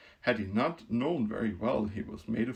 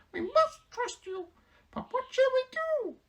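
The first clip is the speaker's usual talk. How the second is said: mouse queen voice; 4 dB louder than usual. Acted. Queen telling the Woodman who she is, proud squeak. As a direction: announce her rank grandly in a squeak.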